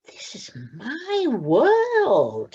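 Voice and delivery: very slow, high, wondering voice; awed little owl. Acted. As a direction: let the wonder sink in. Plop on the rooftops.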